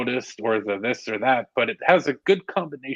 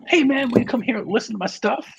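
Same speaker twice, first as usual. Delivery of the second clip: high, bright pleading fan; eager fan voice; 12 semitones higher, 3 dB louder than usual. Retold or imitated. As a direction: beg like a starstruck fan. Fan begging to be heard.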